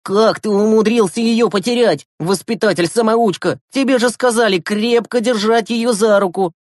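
put-on high-pitched boy telling off a friend; reproachful, emphatic dressing-down; indignant scolding. Gleb scolds Yurasik.

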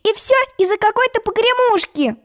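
high, shaming reproach; high scolding girl; reproachful. A narrator's reading. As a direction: make him feel ashamed. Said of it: "пристыдила его Алиса".

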